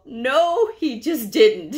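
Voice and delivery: high, loud mock disbelief; can't believe the nerve, hammed up; incredulous. Coder thinking to herself after the insult, incredulous.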